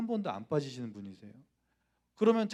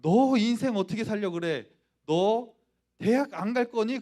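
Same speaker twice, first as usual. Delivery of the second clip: imitating a furious mother, raised and loud; angry scolding; 6 semitones higher, 7 dB louder than usual. Mother confronts son about his future, angrily scolding. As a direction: scold him loudly, voice pitched up. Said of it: "어머니가 화를 막 내시면서".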